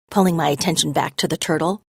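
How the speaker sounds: plain reading voice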